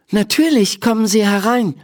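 put-on a shy girl introduces herself; Emma, girl voice. A narrator's reading. Emma tells the doctor her name, shy.

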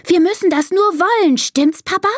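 high, quick little-daughter voice; elephant child. Acted. Elephant daughter seeking Papa's support, high and eager. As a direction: urge it brightly, high and fast. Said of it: "Karls Tochter Dina".

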